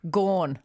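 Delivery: Gone as /kɔn/, rounded aw vowel; says gone. Shown as a demonstration. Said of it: "Go on, say it".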